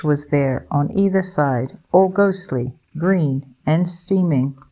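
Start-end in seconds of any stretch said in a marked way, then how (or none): none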